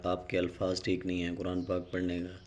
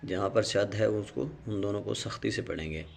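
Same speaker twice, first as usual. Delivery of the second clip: ordinary talk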